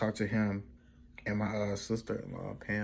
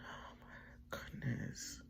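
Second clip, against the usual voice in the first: slow, overwhelmed whisper; drawn-out breathy whisper of disbelief; retold tearful reaction; 3 semitones higher, 14 dB quieter, much slower than usual. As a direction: act out being overcome with tears, voiceless. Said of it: "I started crying".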